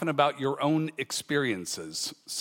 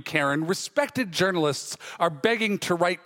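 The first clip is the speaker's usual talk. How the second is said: ordinary talk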